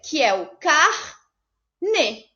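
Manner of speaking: carne said very slowly, split into car and ne; syllable-split carne. Shown as a demonstration. Teacher showing pupils the only way to split carne, very slowly. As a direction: draw out carne with a clear break in the middle. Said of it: "só posso separar de uma única maneira".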